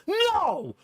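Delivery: high-pitched, bratty mock toddler "no"; toddler refusal. Toddler refusing the cashier's stickers, high mock outburst. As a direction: imitate a two-year-old's outburst. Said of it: "he doesn't just say no".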